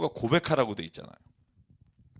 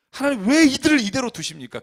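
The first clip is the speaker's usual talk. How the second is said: high, loud outcry in prayer; crying out; 9 semitones higher, 8 dB louder than usual. Retold or imitated. Young homeless helper questions God, crying out loud and high. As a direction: cry out to God, pitched way up. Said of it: "하나님께 부르짖어 기도한 내용이에요".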